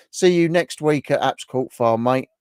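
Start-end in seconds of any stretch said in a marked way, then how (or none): none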